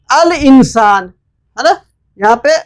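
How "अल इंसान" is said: अल इंसान slow, with a clear अल; al pronounced. Shown as a demonstration. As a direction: keep the लाम sounding in अल इंसान. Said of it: "pronounce करना है अलिफ लाम के साथ".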